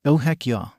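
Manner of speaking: usual speaking voice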